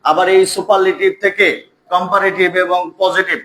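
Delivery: ordinary talk, nothing marked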